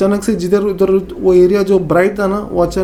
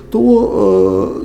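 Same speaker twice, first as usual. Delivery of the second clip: ordinary talk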